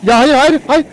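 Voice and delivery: high, loud panicked no; real alarm, shouting no over and over. Real, not acted. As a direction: yelp no as something jumps you. Mob attacking behind him.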